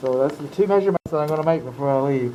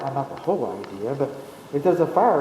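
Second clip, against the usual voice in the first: ordinary delivery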